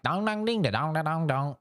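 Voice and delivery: imitating a South Carolina accent with high bouncing syllables; mock South Carolina twang. Streamer mimicking a coworker's South Carolina accent. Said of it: "with the South Carolina accent".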